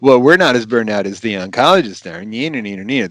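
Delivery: sing-song playground teasing; childish taunt. Acted. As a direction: needle them like a kid in the schoolyard. Mocking a childish rivalry taunt.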